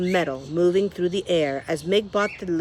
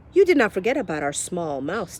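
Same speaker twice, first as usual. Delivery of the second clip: ordinary delivery, nothing marked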